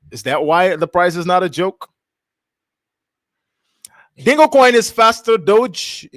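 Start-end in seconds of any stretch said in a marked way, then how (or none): 4.2-6.1 big, slow ad-announcer read; higher, louder, slow promo reading; hyped slogan read. Acted. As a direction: sell it like a trailer voice. Reads slogan out dramatically.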